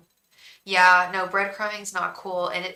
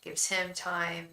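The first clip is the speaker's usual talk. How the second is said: ordinary talk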